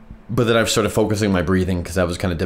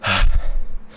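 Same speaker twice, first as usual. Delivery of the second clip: heavy exhale. Real, not acted. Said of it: "Oh, I feel really lightheaded".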